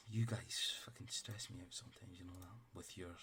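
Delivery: exasperated whisper. Real, not acted. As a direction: breathe it out, fed up. Exasperated aside to players.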